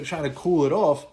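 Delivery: ordinary talk, nothing marked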